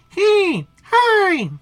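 very high, loud squeaky calls; piercing little creature greeting; weird mystery voice. Acted. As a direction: pipe up in a tiny squeal. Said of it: "do you hear that voice?".

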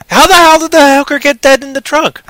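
high, loud, incredulous outburst; acted panicked disbelief. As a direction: shriek the question like a stunned film character. Acts out panicked film character.

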